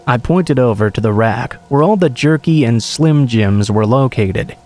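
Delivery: ordinary delivery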